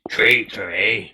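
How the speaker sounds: low, wary character voice; doubtful stranger probing; suspicious NPC voice. Acted.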